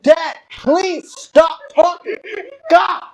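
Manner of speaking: loud, high, drawn-out plea; exasperated. Retold or imitated. Son begging dad to stop talking, exasperated. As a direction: beg him loudly to shut up.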